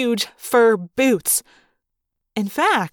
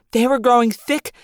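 plain reading voice

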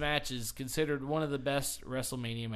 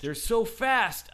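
mock amazed crowd; 9 semitones higher, 8 dB louder, slower than usual.

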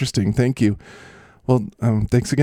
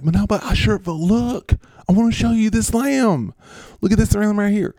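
high, insistent showing-off; C D Lamb; 9 semitones higher than usual. Acted. C D Lamb insists the host see his lamb, high-pitched. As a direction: interrupt eagerly in a high character voice. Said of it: "I'm C D Lamb".

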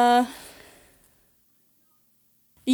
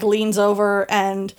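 usual speaking voice

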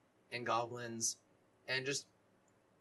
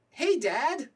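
high, loud shout of greeting; high-pitched, delighted character shout; excited call; 12 semitones higher, 10 dB louder than usual. Acted. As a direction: holler happily to your father. Dylan calls out to his father.

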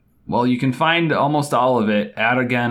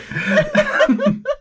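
laugh. Real, not acted. Laughing at audience member joke.

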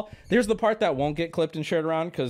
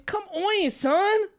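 exasperated pleading; 12 semitones higher than usual. Real, not acted. Begging streamer to drop it.

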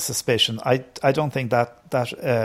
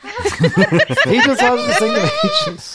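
laughing; 6 dB louder, much slower than usual. Real, not acted. Laughing at the falsetto joke.

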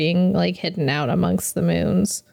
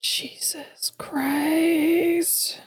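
slow breathy whispered groan; exasperated whisper; 9 semitones higher, 4 dB quieter, much slower than usual. Real, not acted. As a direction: drag it out in a whisper. Dismay at how long ago.

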